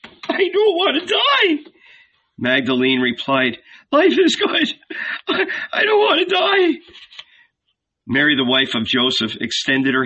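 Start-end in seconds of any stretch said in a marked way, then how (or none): 0.0-1.7 much higher, pleading through tears; tearful plea. A narrator's reading. Terrified sinner pleads with the old woman, weeping. Magdalene weeping, fearing death. 3.9-6.8 much higher, pleading through tears; tearful plea. A narrator's reading. Terrified sinner pleads with the old woman, weeping. Magdalene weeping, fearing death.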